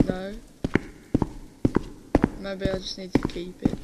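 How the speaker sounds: usual speaking voice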